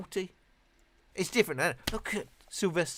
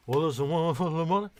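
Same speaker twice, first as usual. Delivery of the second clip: [name] impression; 3 dB louder than usual. Shown as a demonstration. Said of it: "I can do a reasonable [name]".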